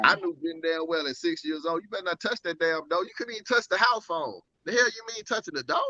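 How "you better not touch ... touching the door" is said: higher-pitched mock indignation; indignant recollection. Recalling house rules at six years old, indignant. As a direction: scold in disbelief, pitched up. Recalls being forbidden to touch door.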